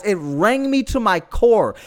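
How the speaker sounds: ordinary talk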